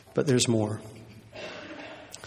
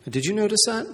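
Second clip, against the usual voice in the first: ordinary delivery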